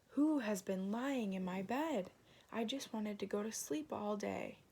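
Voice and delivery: ordinary delivery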